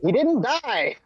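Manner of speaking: high-pitched exclamation; excited squeak of correction; playful protest. Real, not acted. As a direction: object in a high, insistent burst. Objects in playful genre debate.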